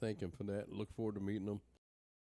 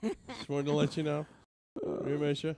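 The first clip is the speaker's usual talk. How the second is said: raised voice with laughter in it; laughing; 4 semitones higher, 5 dB louder than usual. Real, not acted. Letting absent Keegan know he's missed, laughing. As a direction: laugh through a louder, higher call.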